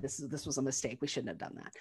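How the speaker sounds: Sally quietly calling it a mistake; quiet, distant. Retold or imitated. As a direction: say it low and withdrawn, pulling away. Sally coldly ending it.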